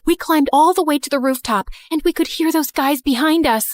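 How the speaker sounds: ordinary delivery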